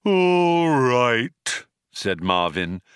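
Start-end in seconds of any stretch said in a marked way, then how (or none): 0.1-1.7 loud, high, drawn-out robot groan; ponderous, resentful robot resignation; tolling cracked bell. A narrator's reading. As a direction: toll it out like a great cracked bell. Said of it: "like the tolling of a great cracked bell".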